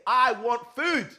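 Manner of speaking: loud, slow, high-pitched demand; imitated demanding baby. Teacher imitating a baby who has learned to talk, demanding. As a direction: imitate a small child demanding, drawn out.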